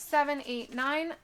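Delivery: normal talking voice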